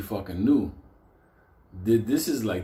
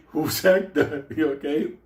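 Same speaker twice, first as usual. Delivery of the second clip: nervous laugh; 7 semitones higher, 6 dB louder than usual. Retold or imitated. Nervous question.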